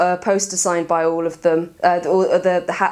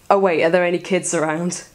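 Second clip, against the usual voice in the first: ordinary delivery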